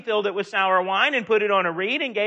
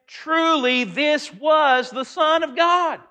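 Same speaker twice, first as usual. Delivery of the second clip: slow awed declaration; 3 semitones higher, slower than usual. Retold or imitated. Centurion confessing before the earthquake, slow and awed. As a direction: proclaim it slowly in awe.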